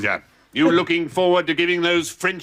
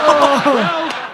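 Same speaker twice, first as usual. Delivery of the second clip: laughing well; 8 semitones higher, 6 dB louder than usual. Acted. General laughing into farewell.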